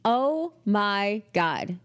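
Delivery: very slow, drawn-out "oh my God"; exasperated exclamation. Real, not acted. Telling listeners of the workload she made, slowly exasperated. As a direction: stretch each word out in disbelief.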